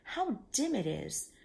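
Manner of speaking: usual speaking voice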